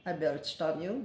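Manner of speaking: ordinary talk, nothing marked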